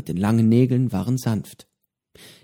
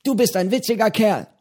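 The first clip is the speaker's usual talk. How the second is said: Johnnys Stimme; 10 semitones higher than usual. A narrator's reading. Johnny spricht zu Petro.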